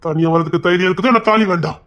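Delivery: usual speaking voice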